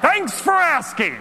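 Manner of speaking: high, loud desperate outburst; yelling out. Acted. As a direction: shout your confession to the whole room. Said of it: "I yell out".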